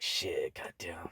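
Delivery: low, whispered swearing under the breath; whispered curse. Retold or imitated. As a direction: hush the curse like it just slipped out.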